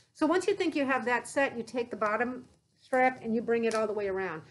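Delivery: muffled behind face mask; speaking into close mask; through an n95 mask. Real, not acted.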